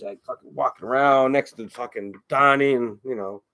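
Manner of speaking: ordinary talk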